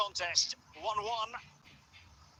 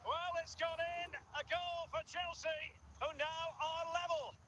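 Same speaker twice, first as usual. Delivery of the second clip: high, sustained commentary cry; excited goal call; 10 semitones higher, 7 dB quieter than usual. Real, not acted. Commentator announcing Chelsea have drawn level, excited. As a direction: hold the voice up high through the announcement.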